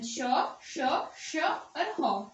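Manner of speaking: "श, श, श और ह" each hissed long and slow; drawn-out sibilant demo. Shown as a demonstration. Teacher drawing out each श and ह slowly for students. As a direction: stretch every श into a long hiss, then a breathy ह. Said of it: "ये थे हमारे संदर्शी और ऊष्म".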